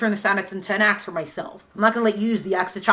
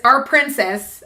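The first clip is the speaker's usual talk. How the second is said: ordinary talk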